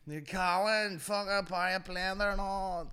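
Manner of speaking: high-pitched hammed-up rowdy lad; out-of-his-mind spide. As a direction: rant like a wasted street lad. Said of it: "out of his mind".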